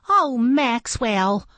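high, slow, grateful sigh of a name; mother duck gushing. A narrator's reading. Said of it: "the mother duck said".

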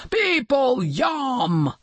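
high, hungry monster voice; storybook monster's gleeful bellow; roaring sea monster. A narrator's reading. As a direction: relish it like a greedy beast. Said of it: "roared the sea monster".

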